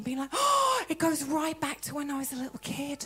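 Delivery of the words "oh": high mock wail; hammed-up tearful cry, pitched high; imitated weeping. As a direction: imitate someone bursting into tears. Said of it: "was literally weeping".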